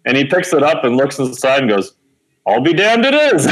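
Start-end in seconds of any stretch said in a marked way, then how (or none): 2.5-3.5 astonished exclamation. Retold or imitated. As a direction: blurt it out like you can't believe your eyes. Admissions guy finds lost application.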